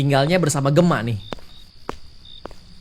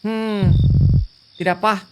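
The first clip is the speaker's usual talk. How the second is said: a bit higher, reading courier and customer lines; lightly voiced game dialogue; 6 semitones higher, 4 dB louder than usual. Courier and customer sort out a wrong address, lightly acted. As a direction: play out the doorstep mix-up lightly in character.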